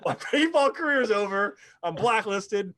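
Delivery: high, loud, laughing mock despair; panicked laughing. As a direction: wail it like your career just ended, half laughing. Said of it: "And I was like".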